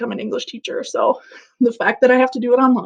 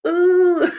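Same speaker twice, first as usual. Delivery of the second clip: mock dramatic ooh; 7 semitones higher than usual. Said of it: "I'm so sorry".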